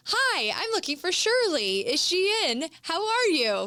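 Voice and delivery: sing-song eager opener; overly friendly cold-call voice; bad cold caller. Shown as a demonstration. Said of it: "We don't want any of that".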